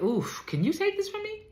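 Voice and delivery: high pleading plea to the Father; pleading Jesus. Acted. Preacher voices Jesus begging God in the garden, high and pleading. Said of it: "so he's like".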